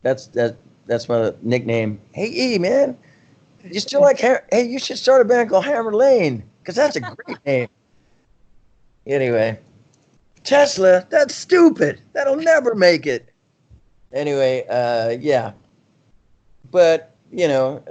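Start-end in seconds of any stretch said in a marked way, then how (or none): 2.1-7.7 teasing bandmates. Retold or imitated. Bandmates teasing him, imitated. 10.4-13.3 high, louder mock scoff at the name; mock scornful dismissal. Mocking his own old verdict.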